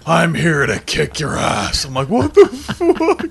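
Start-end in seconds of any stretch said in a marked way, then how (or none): 0.1-1.8 slow, higher, louder threat; menacing thug. Retold or imitated. Stranger at door threatening the tenant, slow menace. 2.1-3.3 baffled disbelief. Retold or imitated. Tenant baffled by the stranger's threat, laughing disbelief.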